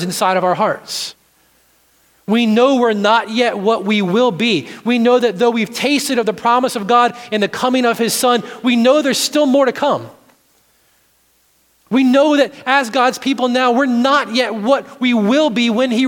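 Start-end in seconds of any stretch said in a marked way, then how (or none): none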